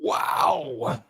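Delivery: long, voiceless, breathy wow; stunned wow. Real, not acted. Commentator taking in the open plea news, stunned. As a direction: let out an astonished breath on the word.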